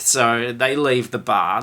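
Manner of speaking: usual speaking voice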